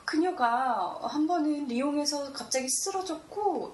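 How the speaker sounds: ordinary talk, nothing marked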